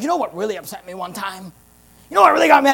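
imitating an angry complainer, building; retold anger. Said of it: "like, really angry".

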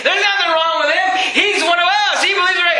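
high, shouted defense in a brawl; shouting. Retold or imitated. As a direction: yell over the fighting crowd.

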